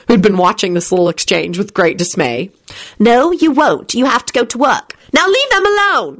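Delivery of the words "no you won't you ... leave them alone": high-pitched, threatening telling-off. A narrator's reading. Rosie ordering William to leave them, threatening. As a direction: warn him off, rising higher at the end. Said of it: "Rosie told him threateningly".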